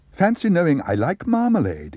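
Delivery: bright, lifted and eager; delighted exclamation. A narrator's reading. Bear amazed the housekeeper knows his taste, delighted exclamation. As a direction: marvel at it like a treat. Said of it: "exclaimed Paddington".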